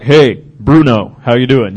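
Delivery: slow, low greeting to a big dog; talking to a big dog. Shown as a demonstration. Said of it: "With big dogs, you're like".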